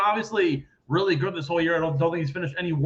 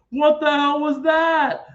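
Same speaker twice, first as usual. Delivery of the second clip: much higher, louder, slow stunned exclaim; mock disbelief; 10 semitones higher, 5 dB louder, slower than usual. Friends stunned after the long-shot bet hit, disbelieving exclaim. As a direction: stretch it out in amazed disbelief.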